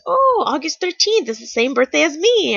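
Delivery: cheerful cashier. Retold or imitated. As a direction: play the cashier's happy surprise. Cashier's remark voiced.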